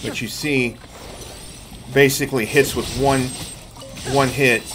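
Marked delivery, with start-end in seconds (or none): none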